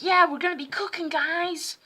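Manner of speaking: high, quick, chirpy teacher voice; cheery teacher impression. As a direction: imitate a teacher cheerfully announcing cooking. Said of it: "she had a way worse voice than that".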